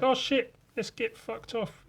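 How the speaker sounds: high-pitched mock goblin panic; alarmed goblins. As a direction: squeal it up high like small goblins fleeing. Acts the goblins fleeing.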